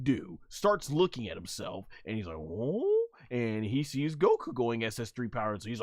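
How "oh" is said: puzzled oh. Retold or imitated. Acts out Doo looking at himself.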